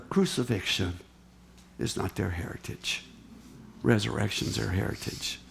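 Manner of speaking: quiet pulpit moment; hushed sermon close. Acted. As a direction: let it land softly after the storm. Preacher drops to a hush.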